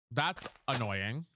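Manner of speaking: that's annoying dragged out low; slow, annoyed drawl. Real, not acted. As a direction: sigh it out, fed up. Drawn out.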